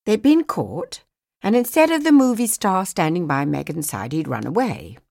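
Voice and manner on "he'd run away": ordinary delivery, nothing marked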